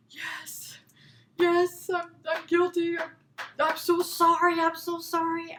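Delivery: high, held pitch, pleading excuse; pleading confession. Retold or imitated. Employee confessing to her boss, high pleading apology. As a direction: blurt out guilt in a high, mock-pleading voice.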